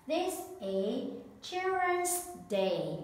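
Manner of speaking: slow, careful teacher voice; slow classroom model; slow presentation. Shown as a demonstration. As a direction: present "Children's Day" slowly and clearly. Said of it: "teacher presents new word".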